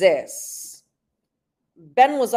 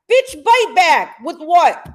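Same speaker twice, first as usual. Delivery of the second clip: louder, higher jeer, punching each word; angry scoff; 5 semitones higher, 6 dB louder than usual. Real, not acted. Streamer scoffing at a rival's threat, angry jeer. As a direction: throw the threat back with loud contempt.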